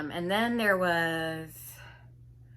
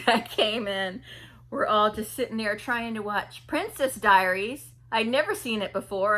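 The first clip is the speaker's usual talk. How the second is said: normal talking voice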